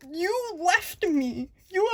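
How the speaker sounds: mock betrayal. Mock complaint to her brother.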